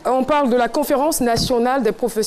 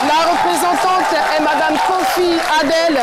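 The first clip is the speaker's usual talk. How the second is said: show host hype; 6 semitones higher than usual. Acted. TV host welcoming guests to the studio, hyped announcer voice. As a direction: greet the studio crowd like a game-show host.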